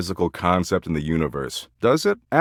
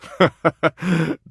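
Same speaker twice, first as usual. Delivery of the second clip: high-pitched burst of laughter; amused laugh; 10 semitones higher than usual. Acted. Character laughing after telling someone they're funny, high laugh. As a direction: let out a high, rippling laugh.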